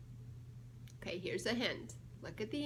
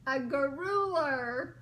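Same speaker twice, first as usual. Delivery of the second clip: drawn-out punchline, louder; slow big reveal; 6 dB louder, slower than usual. Acted. Reading the joke answer to a child, slow and loud. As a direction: stretch out the punchline.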